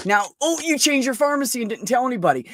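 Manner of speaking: ranting about patients' pharmacy switches; mock exasperation. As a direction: throw up your hands at the patient. Mocking patients' pharmacy changes.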